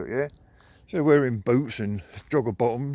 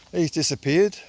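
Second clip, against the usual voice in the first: normal talking voice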